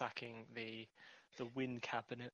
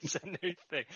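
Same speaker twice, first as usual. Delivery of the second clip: laughing echo; 4 semitones higher than usual. Retold or imitated. Cohost echoes it laughing.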